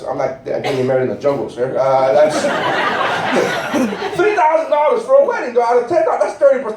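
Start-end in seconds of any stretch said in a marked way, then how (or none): 4.2-6.8 incredulous. Real, not acted. Incredulous about flower cost.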